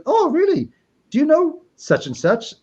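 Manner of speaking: high, drawn-out curious voice; eager questioner. Retold or imitated. Imitates eager woman asking about acquaintance.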